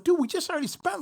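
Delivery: can't believe this, dude; exasperated whine. Retold or imitated. Exasperated about wasted money.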